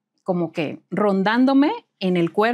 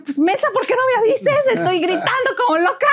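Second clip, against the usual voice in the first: exasperated yell; 13 semitones higher, 3 dB louder than usual. Retold or imitated. Exasperated at friend's late news.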